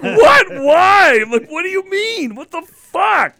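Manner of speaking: high, loud stunned shout; dad shouting in shock at his daughter's verdict; shocked disbelief. Retold or imitated. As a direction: yelp it in total disbelief.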